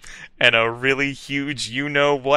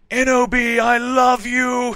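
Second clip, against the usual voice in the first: high, loud, slow cry of love; sad shout; 12 semitones higher, 5 dB louder than usual. A narrator's reading. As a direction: cry it out desperately. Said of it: "he shouted sadly".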